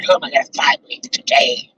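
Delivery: raspy villain whisper; evil voice. Pretend. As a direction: hiss it like a cartoon villain. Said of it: "That's me being evil".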